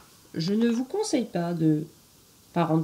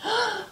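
dramatic gasp. Acted. Gasp before wolf enters.

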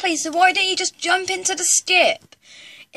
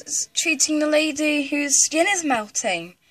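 normal talking voice

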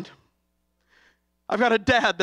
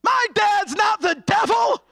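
put-on child standing up; 10 semitones higher, slower than usual. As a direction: shout it like a small boy defending his dad. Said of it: "He stood up on the pew".

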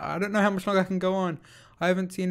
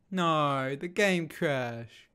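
ordinary talk

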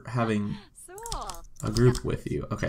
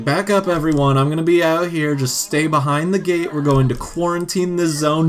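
louder, commanding crowd-control voice; a mock officer clearing the area; bossy official; 5 semitones higher, 5 dB louder than usual. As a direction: order everyone back like a cop at a scene. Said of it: "Angel's just like".

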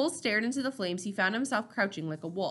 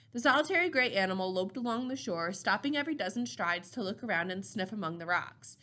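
usual speaking voice

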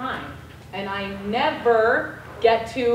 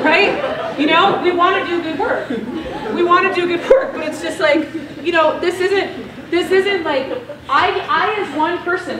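talking through laughter, louder and higher; laughing; 6 semitones higher, 6 dB louder than usual. Real, not acted. Telling the audience she can't topple Mauritania, laughing. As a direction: laugh it off while explaining. Laughing at her own disaster.

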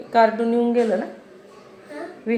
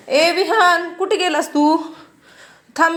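calling out; 8 semitones higher, 5 dB louder, slower than usual. Real, not acted. Calling for son Vihaan.

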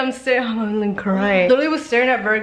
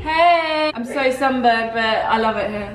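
put-on British accent. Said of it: "We just became British".

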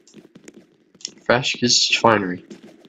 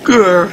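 short, higher, louder mock growl; playful growl; 6 semitones higher, 5 dB louder than usual. Player about to push a guy off, mock growl. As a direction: growl in pretend rage.

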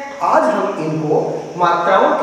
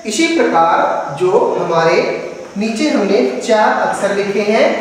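normal talking voice

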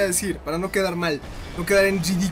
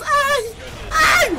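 very high, loud yelps of pain; 5 dB louder than usual. Real, not acted. Gamer squealing as he takes blows, high yelps. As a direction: squeal like you've been punched. Character hit, crying out.

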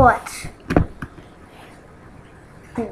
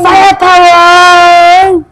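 long, much louder drawn-out shout; 2 semitones higher, 13 dB louder, much slower than usual. Pretend. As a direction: bellow it slowly. Game power-up shout.

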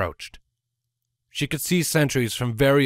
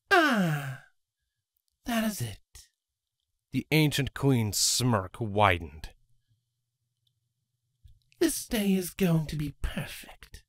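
sinister queen; 7 semitones higher than usual. Acted. Ancient queen voice.

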